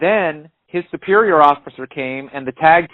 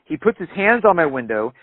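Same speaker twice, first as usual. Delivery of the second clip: usual speaking voice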